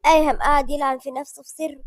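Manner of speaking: usual speaking voice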